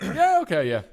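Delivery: high, loud breezy yeah okay; lightly voiced stranger agreeing; mock casual yes. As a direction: toss off an easy yes.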